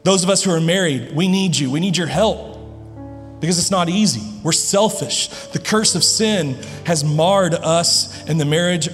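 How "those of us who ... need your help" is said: normal talking voice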